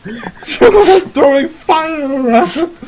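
high, loud, drawn-out villager cry; panicked accusation. Acted. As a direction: wail the accusation with a laugh underneath. Frightened villager accuses the adventurers.

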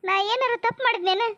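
high-pitched, crying plea; tearful. Acted. Daughter-in-law asking father-in-law if she erred, tearful. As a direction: plead through tears. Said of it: "ಅಳ್ಬೇಡವ್ವ Kamalavva".

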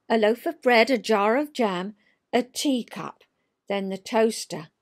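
lilting read-aloud of a rhyming poem; storyteller reading a funny poem. A narrator's reading. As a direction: tell it like a children's verse tale.

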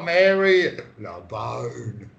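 Mary LeBone, drawn out in separate words; drawn-out pronunciation. Shown as a demonstration. Modelling how Mary LeBone sounds, drawn out. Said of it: "Like that".

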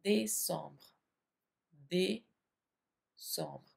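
décembre as day-sombr, nasal om; French décembre. Shown as a demonstration. Teacher modelling French décembre for students. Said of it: "हम उसे French में कहते हैं".

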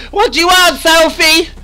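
high, loud sassy challenge to Sophie. Acted. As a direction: confront Sophie with a lot of attitude. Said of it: "if I get like that with Sophie".

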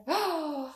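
sharp pretend gasp; playful startled intake of breath; mock scared gasp. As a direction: gasp as if you got a scare. Pretend scare ending the cat rhyme.

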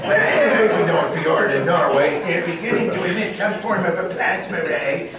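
hammed-up Orson mix; mashed-up Orson. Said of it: "dueling Orsons".

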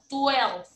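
Twelve with the w said like a Spanish U. Shown as a demonstration. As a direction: say Twelve as "tu-elv", the W close to a U. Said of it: "se pronuncian como si fuera una letra U".

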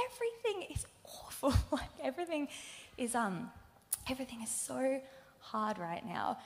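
breathy whisper, voice barely there; whispered despair. Retold or imitated. Telling cheerful family everything is awful, whispered. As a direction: whisper it like all hope is gone. Despairing quote to loved ones.

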